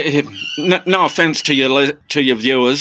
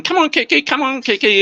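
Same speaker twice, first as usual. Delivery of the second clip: much higher squeaky parrot mimicry; 11 semitones higher than usual. Owner mimicking his parrot calling its own name, high parrot voice. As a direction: pitch it way up like the bird. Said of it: "He'll be going".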